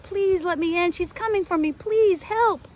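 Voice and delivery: high, loud desperate plea; yelling. A narrator's reading. Girl pounding on the door, yelling for help. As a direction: beg at the door with everything. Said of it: "I yelled".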